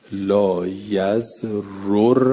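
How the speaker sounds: لا یزررکم drawn out slowly, ending on a rise; slow Arabic recitation of لا یزررکم; slow recitation with damma. Shown as a demonstration. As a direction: spell out لا یزررکم syllable by syllable. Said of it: "الاجود ان یکون اعرابه رفعا".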